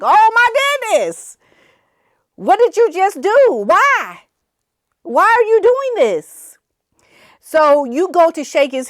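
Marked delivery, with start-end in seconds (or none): 0.0-6.6 high, alarmed mock cry; shocked. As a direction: imitate panic, voice shooting up high. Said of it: "he was really shocked in his head".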